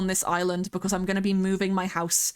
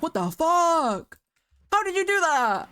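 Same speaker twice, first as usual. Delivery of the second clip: retold shock, pitched higher and slowed; amazed disbelief; 9 semitones higher, 3 dB louder than usual. Streamer retells seeing her friend's edit, stunned. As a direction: replay the jaw-drop moment. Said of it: "and I was like".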